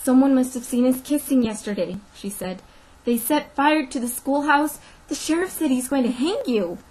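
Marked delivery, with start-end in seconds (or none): 0.0-2.0 higher, loud alarm; urgent warning. Acted. Teacher warns her sweetheart they were seen, urgently. 3.0-6.8 high, loud, anguished warning; urgent alarm. Acted. Teacher warns her sweetheart of the hanging, alarmed.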